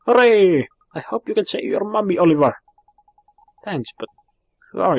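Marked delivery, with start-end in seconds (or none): 0.0-0.7 loud, high burst of joy; cheer. Acted. Child cheering at good news, loud and high. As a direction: throw both arms up with the cheer.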